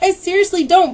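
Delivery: loud, high-pitched protest, re-enacted; mock yelled denial; retold screaming. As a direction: insist over someone yelling. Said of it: "I just kept screaming at her".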